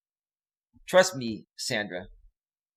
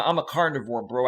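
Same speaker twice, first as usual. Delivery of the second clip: normal talking voice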